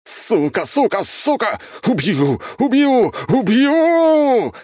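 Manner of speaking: yelling, building to a scream; enraged yelling. A narrator's reading. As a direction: rage rising with every word. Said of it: "орал куратор".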